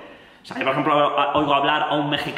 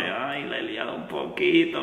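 a soft, lower, sheepish little voice; guilty puppy look; 3 semitones lower, 5 dB quieter than usual. Retold or imitated. Reacting to the singer's guilty glance, softly sheepish. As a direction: play it like you know you messed up a bit. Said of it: "Tiene esa miradita de pichón".

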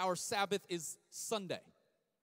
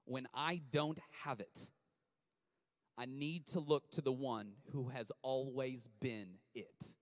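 slow dictation; 6 semitones lower, 5 dB quieter than usual. Shown as a demonstration. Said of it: "Write this down".

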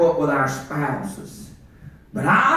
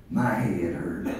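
whispered, low and weak; sick child's whisper; 13 semitones lower, 9 dB quieter, slower than usual. Retold or imitated. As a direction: breathe the words like a hurting child. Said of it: "and her child says".

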